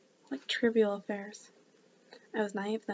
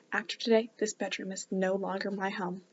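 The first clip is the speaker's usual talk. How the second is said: ordinary delivery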